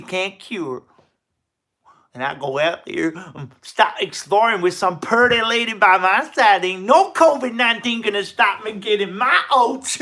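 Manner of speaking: hammy hillbilly drawl, high and loud; loud, slow Appalachian hillbilly; appalachian accent. Acted. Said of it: "an impression of a hillbilly".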